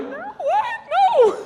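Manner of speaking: very high squeaky disbelief; acted falsetto panic; mock terror. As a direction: squeal in comic fright. Frightened reply to threat of cutting.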